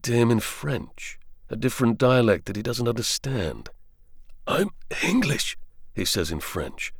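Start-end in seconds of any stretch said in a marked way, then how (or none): 4.5-5.6 lightly voiced young soldier, higher; a hint of a dazed young Englishman; wounded soldier. Arthur's voice in dialogue.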